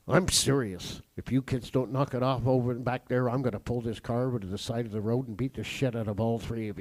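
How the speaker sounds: low, controlled fatherly menace; dad's serious threat. Retold or imitated. Dad warns kids he will pull over, low mock menace. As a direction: drop your pitch and threaten like dad.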